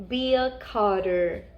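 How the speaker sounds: ordinary delivery, nothing marked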